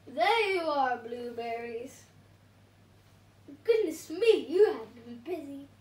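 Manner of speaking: loud, knowing teasing tone; lightly playful feigned innocence; teasing storyteller. A narrator's reading. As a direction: play innocent about the missing villain. Teasing about the Pea.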